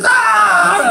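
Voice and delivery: high, loud yelp; kid's cold-water shriek. Retold or imitated. Son hit with cold water, high loud shriek. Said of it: "He's like".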